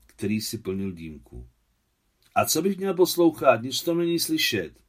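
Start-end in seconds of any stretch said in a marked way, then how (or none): 2.4-4.8 put-on high, loud boyish complaint; indignant protest. A narrator's reading. Boy objects to listening to rain, indignant protest. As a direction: protest loudly, pitched way up.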